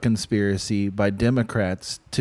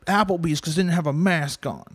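higher whiny mock complaint; whiny mock rapper; 7 semitones higher, 4 dB louder than usual. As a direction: whine like a sulky grown man.

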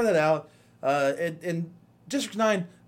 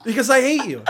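mock rant; 5 semitones higher, 3 dB louder, faster than usual. Mock rant at imaginary viewer.

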